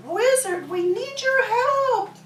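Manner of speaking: high, pleading little voice; pleading frogs. Acted. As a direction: beg the wizard for help in a tiny, high voice.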